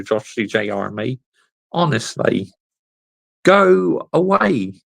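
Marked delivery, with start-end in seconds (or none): 3.4-4.7 slow, loud and higher go away; sing-song get lost, drawn out slowly; drawn-out dismissal. Acted. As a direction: stretch it out to shoo him off. Banning a viewer from chat.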